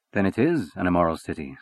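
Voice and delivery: plain reading voice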